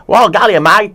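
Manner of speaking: a light, high put-on Scottish accent; mock Scottish accent. Princess from Brave in a light Scottish accent. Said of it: "with an Irish, uh, really thick Irish, uh, accent".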